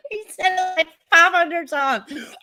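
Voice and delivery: laughing high through the words. Real, not acted. Streamer cracking up retelling Smollett's claim, laughing high. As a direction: squeak it out mid-laugh.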